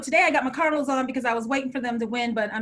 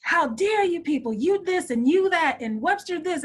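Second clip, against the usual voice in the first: going off; 6 semitones higher, 4 dB louder than usual. Retold or imitated. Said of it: "I went off on Madeline".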